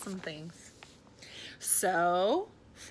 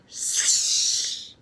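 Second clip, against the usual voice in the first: loud rushing-air swish; sound effect; 8 dB louder than usual. A narrator's reading. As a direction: swish it out like a net through air. Sound effect of swinging net.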